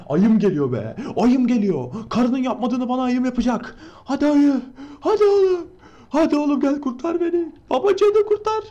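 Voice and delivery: rising, delighted pleading cheer; excited cheering. Real, not acted. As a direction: squeal encouragement at a rescuer. Cheering his bear teammate to rescue.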